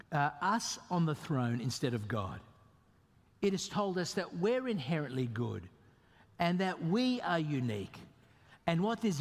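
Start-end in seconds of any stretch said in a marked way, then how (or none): none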